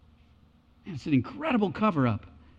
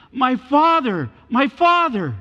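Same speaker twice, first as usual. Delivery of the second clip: loud, high anguished cry; 7 semitones higher, 7 dB louder, slower than usual. Retold or imitated. Elisha crying after Elijah taken in the chariot, loud anguished cry. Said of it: "Elisha cries out".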